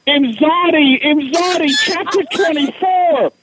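high, chanting fan yell; fangirl yelling. Retold or imitated. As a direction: chant the book title like screaming fans.

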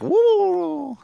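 high, held "woo"; long falsetto woo; turkey-kick whoop. Acted. Imitates the turkey kick sound.

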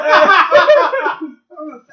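laughing loud and high; words stretched through laughter. Real, not acted. Laughing at afro helmet story.

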